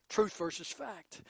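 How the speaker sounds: normal talking voice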